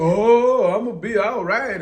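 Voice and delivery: high, loud singsong brush-off; mock surface reassurance. Parent mimicking daughter's surface answer, loud mock breeziness. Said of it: "Not that surface".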